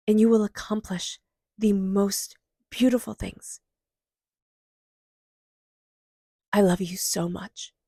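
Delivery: soothing guided meditation read. Acted. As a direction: gently lead listeners to hug their inner child. Guided inner child meditation.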